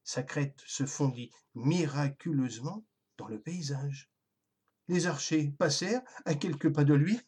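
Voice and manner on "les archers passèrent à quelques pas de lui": usual speaking voice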